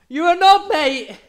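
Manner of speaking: high, loud deadpan blurt; blurted insult. Retold or imitated. Telling friend how Tom blurted insult, loud and high. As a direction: fire it out at the waiter. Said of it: "Tom just goes".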